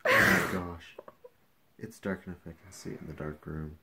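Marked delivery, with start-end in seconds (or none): 0.1-1.0 loud, teary exclamation; overcome, blurting it out; emotional outburst. Real, not acted. Seeing result, loud emotional exclaim.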